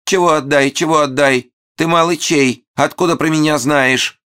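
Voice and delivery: piercing high falsetto squawk; shrill falsetto. Acted. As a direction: shriek it in falsetto. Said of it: "забазарил пронзительным фальцетом".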